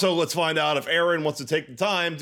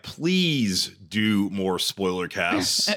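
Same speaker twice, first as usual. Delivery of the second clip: polite request; 8 semitones lower, much slower than usual. Retold or imitated. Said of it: "Obviously, he meant to write".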